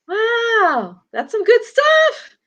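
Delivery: high, loud, slow delight; excited. Real, not acted. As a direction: gush with real excitement. Thrilled reaction to reading.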